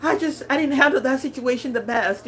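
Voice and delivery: imitating herself upset on the phone; calling her husband, half-crying; retold crying. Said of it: "I call my husband crying like".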